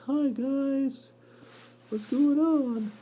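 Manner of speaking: hammed-up clueless spider; oblivious cellar spider. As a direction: innocent little greeting, dragged out.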